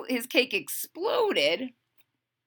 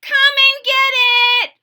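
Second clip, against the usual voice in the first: high loud holler; 11 dB louder than usual. Acted. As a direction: call the family to the kitchen. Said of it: "hollered Froggy".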